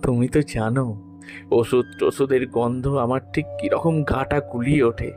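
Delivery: ordinary delivery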